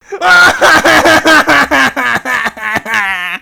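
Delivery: a big rolling chuckle; hearty laugh. Acted. Sailor laughing at his own trouble. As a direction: laugh it out long.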